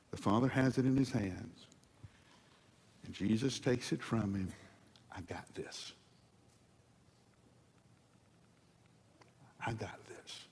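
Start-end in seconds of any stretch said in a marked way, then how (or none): 5.1-10.4 whispered reassurance. Acted. Jesus taking the scroll, voiced.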